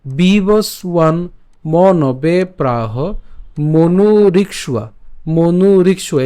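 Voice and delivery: মনু রিক্সুয়া drawn out slowly, clear syllables; slow pronunciation demo. Shown as a demonstration. Teacher repeats the phrase slowly for learners to copy. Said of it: "খেয়াল করুন আরেকবার।".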